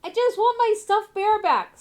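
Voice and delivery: put-on high, loud pleading little boy voice; ghost boy. Ghost boy pleading to the party for his bear. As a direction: beg like a frightened child ghost.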